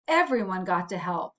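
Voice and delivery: usual speaking voice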